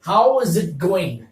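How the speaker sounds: each word drawn out slowly; slow, clear demonstration of the phrase; slow model phrase. Shown as a demonstration. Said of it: "जो पहला phrase आपके सामने है, वो है".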